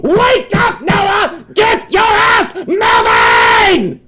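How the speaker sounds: high, loud frantic shouting; screaming inner voice. Retold or imitated. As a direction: yell to wake him. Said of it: "is screaming to you".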